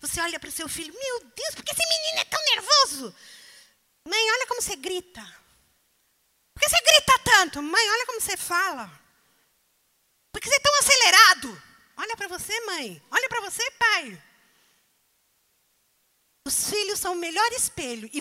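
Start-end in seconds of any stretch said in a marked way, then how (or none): 0.9-3.2 high, fast, exasperated cry to heaven; a mother at her wits' end. Retold or imitated. Parent exasperated at child. 4.1-14.2 high, fast, loud retort; child talking back. Retold or imitated. Child answering the parents back.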